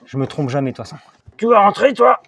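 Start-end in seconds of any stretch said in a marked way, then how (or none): 1.4-2.2 loud, high burst at a stubborn part; exasperated shout of effort; straining exasperation. Real, not acted. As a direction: scold the part while forcing it in. Struggling to push the part in.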